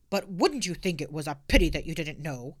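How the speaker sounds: stern officer; lightly voiced policeman.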